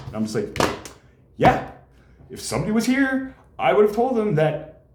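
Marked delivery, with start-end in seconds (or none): 1.4-4.8 mock indignant. Retelling own annoyed reply.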